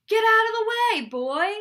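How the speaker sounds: high, very loud command; king yelling someone out of danger; shouted order. A narrator's reading. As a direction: belt it out, pitched well up. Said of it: "ordered the king".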